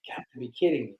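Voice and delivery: unvoiced, defeated whisper; whispered disbelief. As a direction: breathe it out under your breath. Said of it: "whisper heard".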